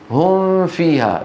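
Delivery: هم فيها slowly, the م held as a nasal blurred into f; wrong ikhfa at fa. Shown as a demonstration. Teacher shows what is not valid, هم with hidden mim. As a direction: hide the م of هم in a long hum before فيها. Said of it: "لا يصح".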